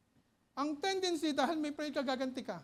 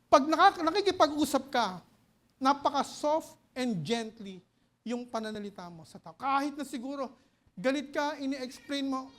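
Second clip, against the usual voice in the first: preaching; 7 dB louder than usual. Acted. Preaching again.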